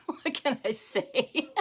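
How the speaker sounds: laughing, slow and high; laughing helplessly at real life. Real, not acted. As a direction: giggle through it, helpless. Laughing after child interruption.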